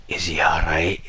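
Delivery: low whispered worry; hushed, afraid for the son. Retold or imitated. Worried dad whispering about his son, low.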